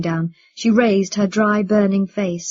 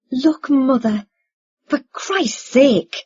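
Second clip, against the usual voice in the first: high, loud, exasperated appeal; 5 semitones higher, 5 dB louder, slower than usual. A narrator's reading. Son pleads with his stalling mother, exasperated. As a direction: draw out the oath in frustration.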